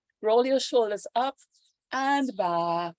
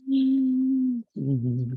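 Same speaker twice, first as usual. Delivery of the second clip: throat breath; 5 semitones higher, 4 dB louder than usual. Shown as a demonstration. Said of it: "let's try some Ujjayi breath".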